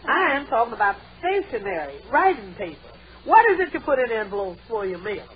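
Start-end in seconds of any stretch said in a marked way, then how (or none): none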